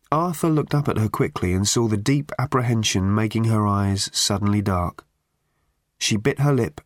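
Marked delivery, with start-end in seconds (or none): none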